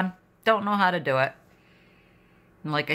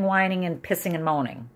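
ordinary delivery

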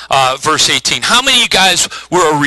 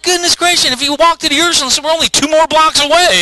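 very high-pitched, fast sputtering; hammed-up incredulous complaint, high and quick; exasperated protest; 13 semitones higher than usual. Dad arguing with his daughter over pickup, exasperated protest.

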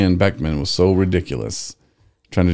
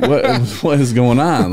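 laughing; 7 semitones higher, 4 dB louder, slower than usual. Real, not acted. Laughing disbelief at a story.

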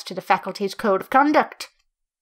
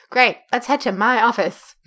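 usual speaking voice

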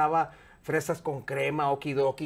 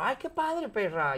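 a lightly voiced camp friend, much higher; 6 semitones higher, slower than usual. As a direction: gush it high like a camp friend. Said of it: "yo hablando con él igual que él".

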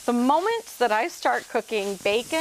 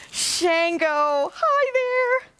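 high, singsong greeting; calling out; 8 semitones higher, much slower than usual. Real, not acted. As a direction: call someone over with a very high, held hello. Calling a helper over.

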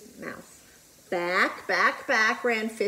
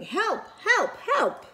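mild mock shout; 11 semitones higher, slower than usual. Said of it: "She's shouting".

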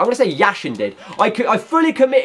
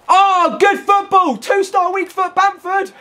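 high, loud, slow cheer; excited celebration; 13 semitones higher, 3 dB louder, slower than usual. Real, not acted. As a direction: cheer the goal at the top of the voice. Celebrating a goal.

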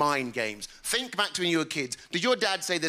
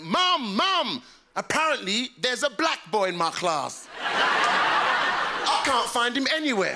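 put-on higher child's voice calling Mum; excited schoolboy; 6 semitones higher, much slower than usual. Small boy telling Mum about his class, mock-excited. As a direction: run home bursting with news, drawn out. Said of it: "my first day in my primary school".

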